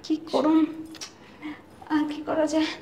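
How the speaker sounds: very soft, secretive worry; hushed. Acted. Wife to husband while father waits outside, hushed. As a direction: keep it from carrying to the door. Couple plotting secretly indoors.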